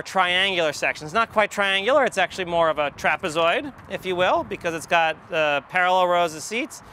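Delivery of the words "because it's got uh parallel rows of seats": normal talking voice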